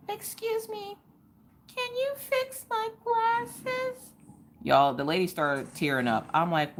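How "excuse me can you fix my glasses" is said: put-on high, slow, tiny little-girl voice; little girl's sweet plea. Seven-year-old asking the optometrist for help, high and sweet. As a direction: play up the pitiful child asking nicely. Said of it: "and she goes".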